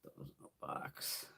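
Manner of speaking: slow unvoiced whisper to herself; slow breathy aside. Real, not acted. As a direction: murmur it slowly under your breath. Muttered aside while tidying.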